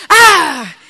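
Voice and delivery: one sudden loud yell, like a preacher bursting out; sudden shout. Retold or imitated. Preacher startling the congregation with a sudden shout. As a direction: startle the room with a single loud cry. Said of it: "pues, ¿por qué grita tanto?".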